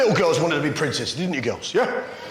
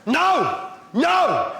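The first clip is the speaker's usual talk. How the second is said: emphatic no; 8 semitones higher, much slower than usual. Acted. Emphatic mock protest.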